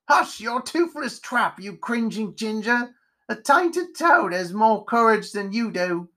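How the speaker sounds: high-pitched scolding insults; crotchety scornful spider. Acted. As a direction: tell off a coward in a character voice. Spider snaps back.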